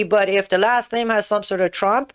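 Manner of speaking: ordinary talk, nothing marked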